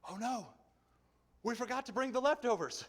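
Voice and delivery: hammed-up dismay over forgotten bread; panicky disciples. Disciples fretting to each other about bread, mock panic.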